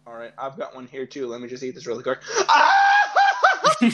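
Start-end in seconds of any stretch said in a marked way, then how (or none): 2.5-3.9 high loud laughing shriek; laughing scream. Real, not acted. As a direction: yelp in startled laughter. Reacts to cold milk carton.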